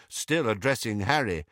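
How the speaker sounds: plain reading voice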